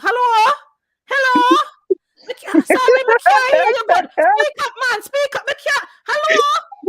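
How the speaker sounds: put-on high, loud, hard-of-hearing old lady; granny voice. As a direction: shout like a deaf grandma on the phone. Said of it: "I put on my granny voice".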